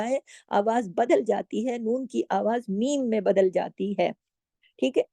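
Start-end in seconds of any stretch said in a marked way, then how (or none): none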